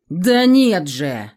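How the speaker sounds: loud, slow emphatic cry; exclaiming. A narrator's reading. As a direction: bang the table and exclaim. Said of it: "воскликнул страж".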